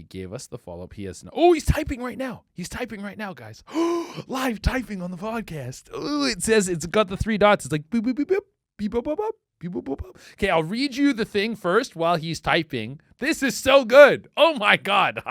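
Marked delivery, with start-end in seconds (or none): 1.3-3.3 high, loud, thrilled; sudden thrilled discovery; excited. Real, not acted. Excited at live typing. 3.7-4.2 excited gasp. Real, not acted. 7.9-10.2 high chirpy boops; typing sound effects. Pretend. Imitating typing dots. 13.2-15.2 loud high delight; delighted outburst; thrilled. Real, not acted. Thrilled reading the post.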